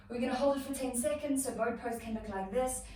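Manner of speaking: ordinary delivery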